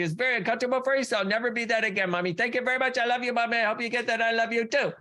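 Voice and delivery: put-on high, fast little-girl voice; a small girl vowing to shrink for mommy; childlike promise. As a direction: daughter promises her mother she'll stay small, high and childlike. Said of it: "And you're like".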